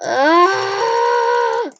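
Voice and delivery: sudden high cry of strain; short strained shout; pained cry. Real, not acted. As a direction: yelp as the finger gives out. Finger hurts from spamming.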